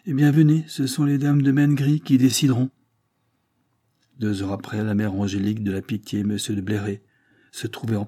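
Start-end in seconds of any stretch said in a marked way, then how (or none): 0.1-2.7 raised, higher, louder challenge; insistent call. Acted. Madame de Sipierre to the Breton, raised insistent voice. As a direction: rise up and push them to decide.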